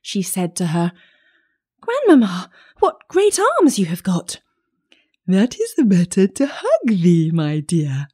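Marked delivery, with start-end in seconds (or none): 1.8-4.4 put-on high, loud child's exclamation; amazed little girl. A narrator's reading. Child staring at disguised grandmother, amazed high voice. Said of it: "being greatly amazed". 5.3-8.1 lightly voiced wolf, reassuring; a hint of disguised creature, falling at the end; wolf as grandmother. A narrator's reading. Wolf explaining himself to the child, mild wolf voice.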